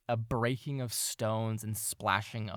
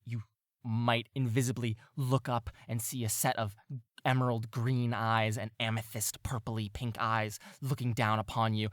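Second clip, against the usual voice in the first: normal talking voice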